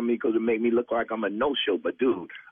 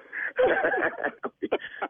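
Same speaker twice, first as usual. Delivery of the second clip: a long, high, airless laugh; breathy wheezing laugh. Real, not acted. Pranked singer laughing breathlessly at the caller.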